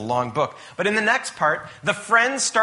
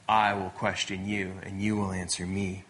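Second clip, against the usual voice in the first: low, softer, measured divine authority; God addressing Job; 6 semitones lower, 5 dB quieter than usual. Retold or imitated. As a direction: lay down who does the questioning, quietly. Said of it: "stands before Job and says this".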